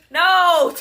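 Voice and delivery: loud, high, drawn-out no; retold loud refusal. Retelling her answer to the driver, loud refusal. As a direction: exclaim a mock-outraged refusal.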